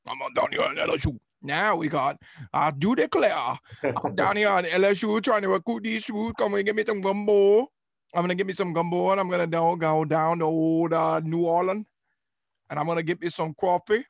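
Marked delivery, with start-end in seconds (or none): 0.0-1.2 mock Louisiana coach voice. Imitating the LSU coach, mock Cajun voice. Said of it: "So we had the Coach O like". 2.5-14.0 Foghorn Leghorn. Retold or imitated. Mocking the LSU coach as a loud cartoon rooster. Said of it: "Foghorn Leghorn".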